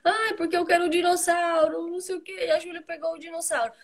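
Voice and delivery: high, whiny mock complaint; imitating crying child. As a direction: act out an upset kid telling on a sibling. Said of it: "Por que que você tá chorando?". (imitando criança chorosa, voz aguda)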